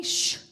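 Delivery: drawn-out loud "shh"; curt shush. Retold or imitated. Hurt devotee quoting the guru, curt shush. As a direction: hush hard, imitating him. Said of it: "he's telling me".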